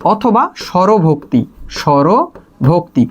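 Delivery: বিপ্রকর্ষ and স্বরভক্তি said very slowly, syllable by syllable; slow spelled-out term. Shown as a demonstration. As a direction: drag out each syllable so students catch the term. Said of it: "বলা হয় হচ্ছে বিপ্রকর্ষ". (ধীরে ধীরে স্পষ্ট উচ্চারণ)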